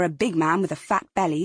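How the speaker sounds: usual speaking voice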